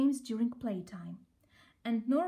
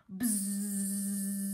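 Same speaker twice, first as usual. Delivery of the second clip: fearsome buzz; 3 semitones lower than usual. A narrator's reading. Fearsome giant bee arrives.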